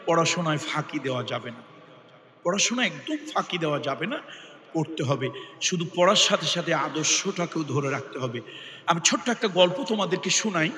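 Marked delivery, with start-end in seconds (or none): 0.1-8.4 much softer and lower, a quiet plea; soft earnest urging. Real, not acted. Preacher pleading quietly with students not to skip study. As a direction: sink the voice low and press the point gently.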